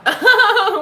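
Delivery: high loud giggling burst; real laugh. Real, not acted. Host amused by own dentist bit, laughing high. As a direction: crack up mid-bit.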